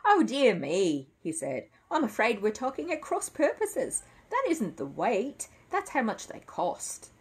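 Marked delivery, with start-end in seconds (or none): 0.0-1.1 slow, loud "oh, dear me"; indulgent, stretched-out exclamation; amused dismay. A narrator's reading. Crisp amused at the misunderstanding. 1.9-7.1 high-pitched, polite correction; lifted character voice, slowing as it explains; amused shop man explaining. Acted. Crisp explains the misunderstanding.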